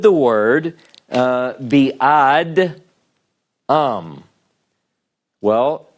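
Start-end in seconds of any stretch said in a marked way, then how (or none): 2.0-4.2 "ad, um" as two separate drawn-out pieces; wrongly split syllables. Shown as a demonstration. Teacher testing a syllable division, split with a pause. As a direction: break after the d in "ad", pause, then "um". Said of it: "that we divide it between".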